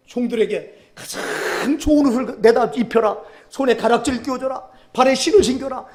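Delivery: loud, high joyful proclamation; fervent preaching. Acted. Preacher telling the prodigal's return, loud and joyful. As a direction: proclaim the father's joy to the crowd.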